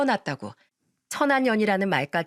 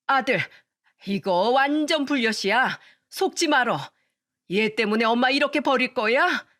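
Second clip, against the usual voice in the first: pleading mother; 3 semitones higher than usual. Acted. Mother-in-law pleads with son.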